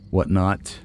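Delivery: ordinary delivery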